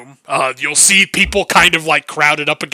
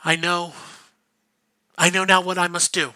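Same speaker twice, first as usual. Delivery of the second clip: put-on a soft, higher, tired young woman's voice; lightly voiced, spent and resolute; weary priestess; 4 semitones higher, 4 dB quieter than usual. Seiko, just awoken, sending Akano away, soft and tired.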